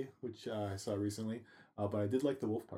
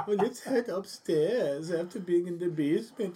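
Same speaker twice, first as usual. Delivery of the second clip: high, slow hammed-up German voice; mock German accent; 9 semitones higher, 5 dB louder, slower than usual. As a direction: shiver it out in a silly German accent.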